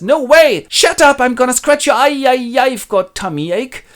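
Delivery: high, loud catfight screech; fly at her sister; jealous sister. Acted. Jealous Goneril shrieks at Regan, high and loud. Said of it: "a jealous sister replies".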